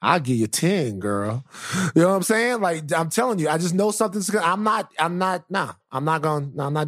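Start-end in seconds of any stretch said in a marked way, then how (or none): 0.0-1.4 low, dry dismissive dad voice; dad turning down the podcast host, low and dismissive; imitating dad. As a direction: brush her off, deep and unimpressed. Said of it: "He's gonna be like".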